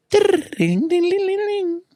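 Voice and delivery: high trilled sing-song ringing; tinkling "ding-a-ling" in a high pitch; chime impression. Shown as a demonstration. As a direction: badly imitate magical chimes, very high. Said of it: "the worst impression of chimes".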